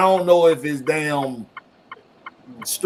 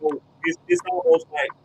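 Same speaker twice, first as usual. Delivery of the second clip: ordinary delivery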